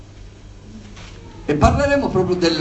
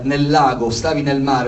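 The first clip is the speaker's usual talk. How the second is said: normal talking voice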